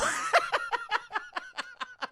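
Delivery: high squealing laugh; high cackle. Real, not acted. Streamer cracking up at the line, high laugh. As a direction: burst into giggles.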